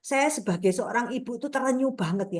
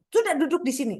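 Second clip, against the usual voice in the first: higher-pitched mock order to a child; retold motherly command, pitch raised; retold scolding; 5 semitones higher, faster than usual. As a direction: act out a mother's telling-off.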